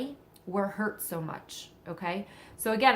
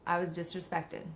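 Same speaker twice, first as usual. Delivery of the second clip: softer, hushed repeat; quiet echo; 2 semitones lower, 7 dB quieter than usual. Pretend. Speaker repeats the real feeling to herself, softer. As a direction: drop the voice and let it land.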